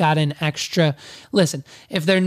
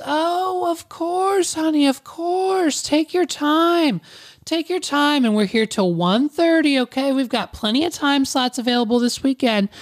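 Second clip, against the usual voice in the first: Susan; 8 semitones higher than usual. Retold or imitated. Receptionist telling a guest to take their time, gushing impression. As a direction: pitch it way up, over-obliging receptionist. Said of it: "And she says".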